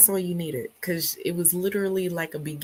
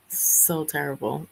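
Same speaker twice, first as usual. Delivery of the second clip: ordinary talk, nothing marked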